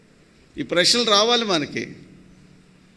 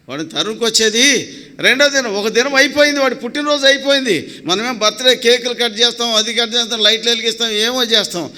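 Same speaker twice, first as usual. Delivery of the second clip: raised, louder pulpit delivery; forceful preaching; 4 semitones higher, 5 dB louder than usual. Real, not acted. Preacher contrasts birthday cakes with coming death, forceful preaching. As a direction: drive each phrase home at high pitch.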